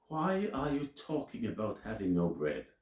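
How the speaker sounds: ordinary talk, nothing marked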